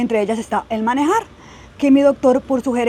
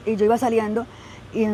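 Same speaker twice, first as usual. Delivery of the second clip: normal talking voice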